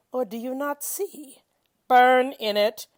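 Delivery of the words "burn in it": slow, loud, stern command. Acted. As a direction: slam each word down slowly and loudly. Reciter voices the command to burn.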